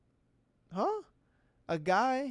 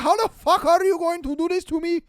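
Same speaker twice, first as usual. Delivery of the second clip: put-on loud, high, aggrieved Middle Eastern accent; hammed-up outraged driver demanding an answer; mock raging; 9 semitones higher, 9 dB louder than usual. Wronged driver to the people in the truck, mock outrage. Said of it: "starts raging at us".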